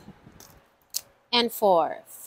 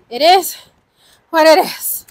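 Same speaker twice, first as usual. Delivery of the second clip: raised, punchy, word by word; emphatic declaration; 5 semitones higher, 9 dB louder than usual. Real, not acted. Host accepts the dice total, loud emphatic declaration. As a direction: belt it out high and loud.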